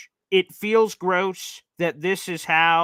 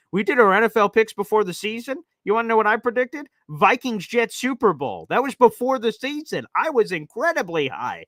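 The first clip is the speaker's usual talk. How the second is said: excited boast; 6 semitones higher than usual. Real, not acted. Host bragging to viewers about his preseason pick, excited. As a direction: gloat to the listeners.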